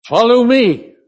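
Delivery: high, loud Lancashire call; broad Lancashire accent. Retold or imitated. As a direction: imitate a Lancashire local calling out. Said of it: "in his broad Lancashire accent".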